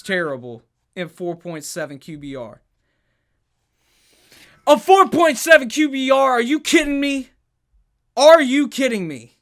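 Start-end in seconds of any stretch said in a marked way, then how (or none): 4.7-9.4 much higher, much louder exasperated yell; loud, high "Are you kidding me?" rant; outraged disbelief. Real, not acted. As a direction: explode at the stat in disbelief. Outraged at terrible quarterback stat.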